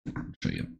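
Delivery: soft voiceless aside, mouth full; whispered aside. Real, not acted. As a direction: breathe it off-mic. Aside while chewing fudge.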